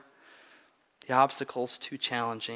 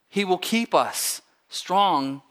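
slow, higher, "keep" given weight; stress on keep; 5 semitones higher, 4 dB louder, much slower than usual. Shown as a demonstration. Preacher stresses God's ongoing sustenance, stress on "keep". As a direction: lean on "keep", then set "strong" apart. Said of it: "We could underline the next word".

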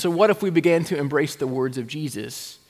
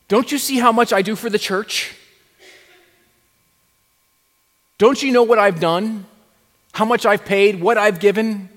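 indignant, self-righteous complaint, pushed louder; mock grumbling churchgoer; 5 semitones higher, 8 dB louder than usual. Pastor mocking a self-important member, hammed-up indignation. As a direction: play the put-upon church member.

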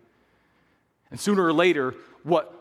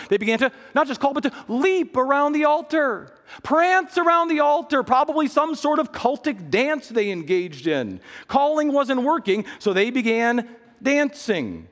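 fervent preaching; 9 semitones higher than usual. Acted. Preacher building up the congregation, voice climbing higher.

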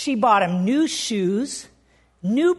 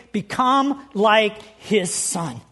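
slow repeated stress; 3 semitones lower, much slower than usual. Acted. Repeats phrase for underlining.

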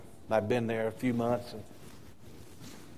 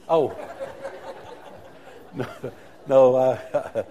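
laughing; 6 dB louder, much slower than usual. Real, not acted. Joke about faces seen too often.

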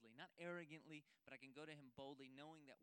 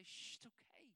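tender hush; 8 semitones higher than usual. Acted. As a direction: whisper comfort into a frightened ear. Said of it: "let our Father grab you and hold you in his arms and say".

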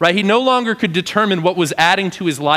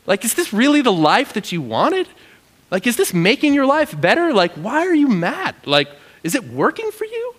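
very high, disbelieving questions; incredulous God; 8 semitones higher than usual. Acted. God asking Jonah why he's mad, very high and incredulous. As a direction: pester him in disbelief. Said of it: "And, like".

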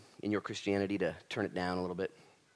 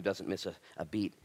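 usual speaking voice

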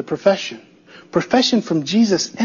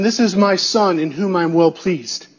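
normal talking voice

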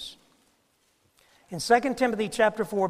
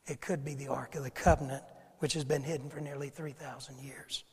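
whispering; 5 semitones lower, 9 dB quieter than usual. Acted. Secret hidden Ark.

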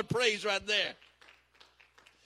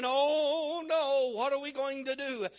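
higher, drawn-out mock moan; mock whining; 4 semitones higher than usual. Preacher mimics the rest who wallow, mock whining. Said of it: "whining and moaning like the rest".

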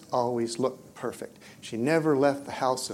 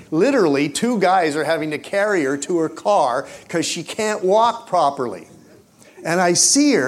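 raised, loud, animated preaching; higher and louder, caught up in the tale; worked-up storytelling; 5 semitones higher, 7 dB louder than usual. Real, not acted. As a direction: build the story to an excited peak. Preacher builds to story's climax.

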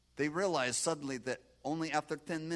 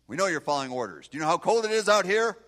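officer scolding; 6 semitones higher, 15 dB louder than usual. Retold or imitated. Officer scolds soldier in cold.

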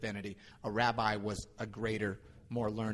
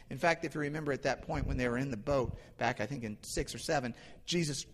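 ordinary talk, nothing marked

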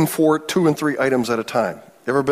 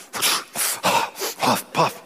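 impatient puffing; 4 dB quieter than usual. Retold or imitated. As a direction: mimic annoyed puffs of breath. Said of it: "they were uttering".

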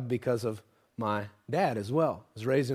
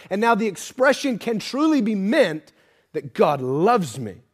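loud, high, building preaching; full-force preaching; 7 semitones higher, 9 dB louder than usual. Acted. Preacher pressing the listeners on God's love, loud and high. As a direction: drive it home to the congregation, loud.

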